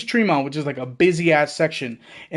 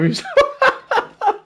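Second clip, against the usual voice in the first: laughing. Real, not acted. Laughing retelling of crying.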